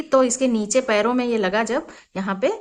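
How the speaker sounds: normal talking voice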